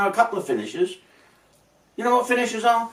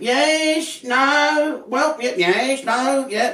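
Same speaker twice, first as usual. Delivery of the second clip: much higher dithering "yes, no, yeah"; higher, flustered yes-no dithering; mock yes-no answer; 9 semitones higher, much slower than usual. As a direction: ham up a bloke who can't commit. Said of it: "What do you think, Dave?".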